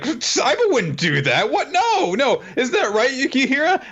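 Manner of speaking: high, flustered, nervous mock denial. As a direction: squeak it out like you are covering something up. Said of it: "Erina is immediately like".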